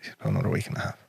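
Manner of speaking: unvoiced, breathy hush; whispered. Real, not acted. Telling a friend quietly how long his dying father had left. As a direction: say it in a whisper, as if the words are too heavy.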